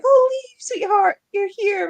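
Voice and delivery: high, sweet gushing parents; doting parents voice. Retold or imitated. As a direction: coo it like doting parents greeting their boy. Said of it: "And they were like".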